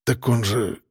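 officer babbling in confusion at his angry boss; confused babble. A narrator's reading. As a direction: falter out a helpless excuse. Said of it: "растерянно пролепетал Выдрин".

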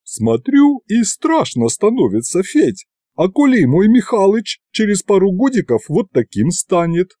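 high-pitched worried auntie; anxious auntie confiding her dread; fretting aunt. Acted. Voices worried aunt Yaguta.

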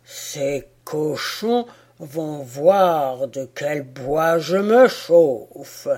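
a giant's furious slow threat; the giant growls vengeance at the boars; growling threat. Acted.

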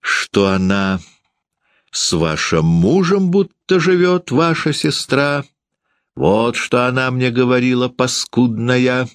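high, insistent tattling voice; spiteful landlady. A narrator's reading. Landlady exposing gossip to the guest, spiteful high cry. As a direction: tell tales on a sustained high pitch.

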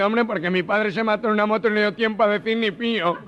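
high nasal punchline voice with a laugh; gangoso. Acted. Gangoso explaining to the pasota why he said pío, high and laughing. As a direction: deliver the punchline in character.

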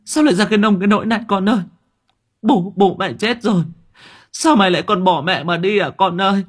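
a mother howling over her dead son; wailing in grief. Acted. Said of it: "người đàn bà đang vật vã gào khóc".

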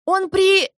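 yell, then get muffled; shouting cut off. A narrator's reading.

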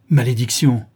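loud, sudden curse; crying out. A narrator's reading. Dorian curses at the woman's taunt, crying out. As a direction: hurl out the curse. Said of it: "cria-t-il".

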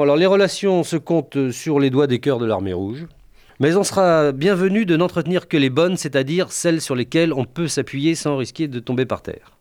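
normal talking voice

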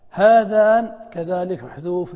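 هذان hit loud and rising; stressed example word. Shown as a demonstration. Teacher stressing the example for the class, loud. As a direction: lean hard on هذان. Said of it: "لأن اسم الإشارة مبدوء".

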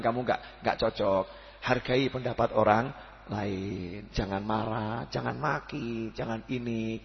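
soft, low fatherly advice; gentle advice. Retold or imitated. Father gently advising his son, soft and low. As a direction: talk it down gently to your child. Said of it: "Cukup ngasih tahu".

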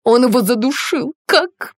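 high, loud, distraught outburst; hysterical cry. A narrator's reading. Woman's hysterical answer continues. (истеричный громкий высокий выкрик)

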